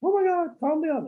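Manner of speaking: very high, frantic squeal of panic; mock panic in a shrill squeal; panicked shriek. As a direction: shriek it like a terrified man. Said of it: "he's out there going".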